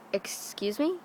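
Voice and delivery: hushed, baffled question; softly puzzled mock customer. Puzzled customers question the dad at work, baffled.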